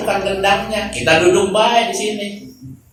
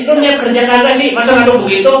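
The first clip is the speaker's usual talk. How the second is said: foreman scolding; 6 semitones higher, 3 dB louder, faster than usual. Retold or imitated. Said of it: "Sama tukang diomelin".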